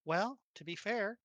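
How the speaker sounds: usual speaking voice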